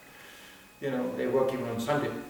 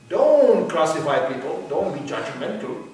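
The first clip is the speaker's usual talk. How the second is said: mock scolding; 8 dB louder than usual. Voicing a scolding critic.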